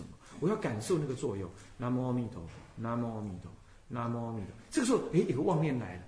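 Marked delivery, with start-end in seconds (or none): 1.8-4.5 low, soft, even Buddha-name chant; quiet meditative chanting; gentle recitation. Shown as a demonstration. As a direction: repeat the name lightly and steadily. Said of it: "轻轻的提着".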